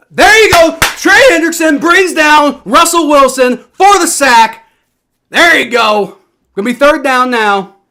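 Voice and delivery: high, loud elated yell; excited cheering. Real, not acted. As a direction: roar in celebration of the sack. Sack by own team's defense.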